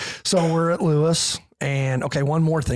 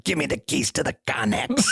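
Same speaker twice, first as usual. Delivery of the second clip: imitating the sergeant; 3 semitones higher than usual. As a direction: bark it the way the sergeant did. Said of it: "he talked like this".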